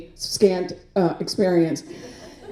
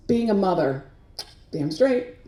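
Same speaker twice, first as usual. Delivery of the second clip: ordinary delivery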